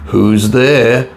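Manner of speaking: a touch muffled, called from under covers; wolf calling out as if through a quilt; lightly muffled wolf-as-granny. A narrator's reading. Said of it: "called a muffled voice".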